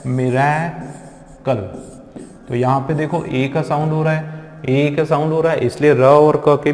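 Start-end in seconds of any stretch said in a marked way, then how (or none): 0.1-1.6 miracle said slowly; slow demo word. Shown as a demonstration. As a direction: draw out miracle, sounding each syllable. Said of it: "एक शब्द होता है".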